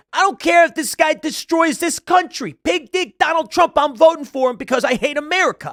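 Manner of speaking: high, loud mocking impression of a ranting actor; high shouty mock impression; imitating Michael Rapaport. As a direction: ham up a ranting New York actor voice. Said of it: "He could be like".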